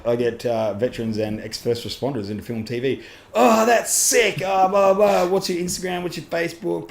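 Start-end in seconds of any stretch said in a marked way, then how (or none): 3.3-6.9 high-pitched, put-on starstruck voice; hammed-up fan excitement, pitched up; mock gushing fan. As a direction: gush like an overexcited fan. Imitating gushing fans at the scene.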